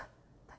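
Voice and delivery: voiceless P burst with no vowel; just the puff of P, no vowel after; bare consonant P; isolated P sound. Shown as a demonstration. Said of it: "aqui nós temos o som P".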